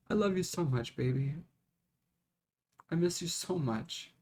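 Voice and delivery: soft, hushed tender words; tender to lost pet. Real, not acted. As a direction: murmur it to her ashes softly. Speaking to her pet's ashes.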